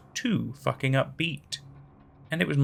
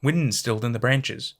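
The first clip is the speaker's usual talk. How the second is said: usual speaking voice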